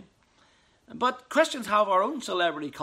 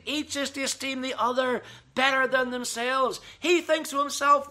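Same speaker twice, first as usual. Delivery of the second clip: ordinary delivery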